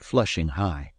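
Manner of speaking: usual speaking voice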